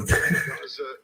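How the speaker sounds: soft, airy laugh; amused laugh. Real, not acted. Streamer recalling France's passes, laughing softly. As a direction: chuckle softly at the memory.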